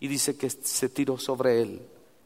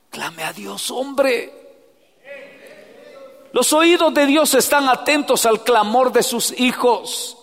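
loud, high preacher cry; preaching at full force; 10 semitones higher, 7 dB louder than usual. Acted. Preacher urging the congregation to cry out to God, loud and high. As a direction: lift it into a pleading call.

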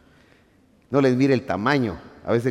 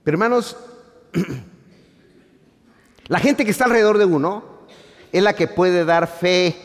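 louder and higher, pushing the point; emphatic repeat; 7 semitones higher, 7 dB louder than usual. Acted. Preacher to church, repeating it forcefully. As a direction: hammer the repeated line home. Repeated line driven home.

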